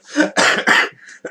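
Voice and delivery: loud chesty cough; harsh cough. Real, not acted. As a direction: hack out a sick cough. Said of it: "I'm dying of man flu still".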